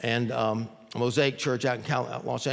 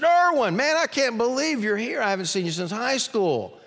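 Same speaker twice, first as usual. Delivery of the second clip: much higher and louder, overjoyed to see him; old friend thrilled at the door; delighted greeting; 11 semitones higher, 5 dB louder than usual. Retold or imitated. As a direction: call out in happy surprise.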